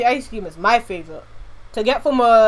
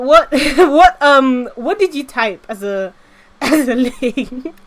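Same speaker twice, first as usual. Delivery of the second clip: laughing disbelief; 3 semitones higher, 5 dB louder, slower than usual. Real, not acted. Streamer laughing at a viewer's odd link, laughing. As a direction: laugh through the question.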